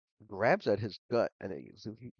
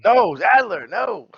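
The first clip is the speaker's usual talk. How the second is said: very loud, high-pitched protest; alarmed shout; 14 semitones higher, 19 dB louder than usual. Acted. Companion stopping Adler handing over the dagger, alarmed shout. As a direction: shout to stop him at once.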